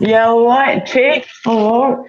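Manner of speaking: high, cheeky Cockney all right chick; Cockney accent. Retold or imitated. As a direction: greet them like a London market trader, in Cockney. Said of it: "you know a bit of Cockney".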